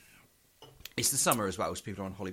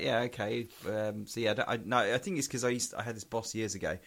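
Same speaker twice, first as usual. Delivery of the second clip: ordinary talk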